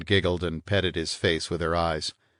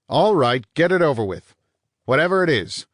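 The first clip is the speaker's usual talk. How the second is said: louder and higher, impatient; irritated bark; 8 semitones higher, 7 dB louder than usual. Acted. Vanier impatiently tells the detective to speak, loud. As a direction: get it over with, fed up.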